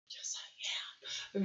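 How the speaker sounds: slow hushed confession; whispered aside. Acted. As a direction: whisper it to yourself.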